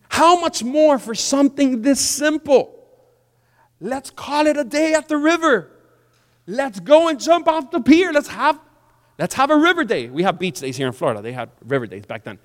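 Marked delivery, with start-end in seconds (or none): 0.1-10.1 high, loud, rousing appeal; coaxing servants. Acted. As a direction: talk the boss into a day out. Servants coaxing commander to the river.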